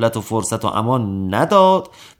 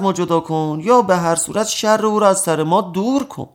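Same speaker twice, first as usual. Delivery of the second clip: higher-pitched, aggrieved appeal; lightly acted plea, pitched up; pleading complaint; 6 semitones higher than usual. Prisoners beg the judge to remove him, pleading.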